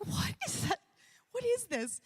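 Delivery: high breathy "what is that"; startled. Retold or imitated. Preacher retelling hearing a strange voice, startled and high. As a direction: jump up in pitch, startled. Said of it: "And I'm like".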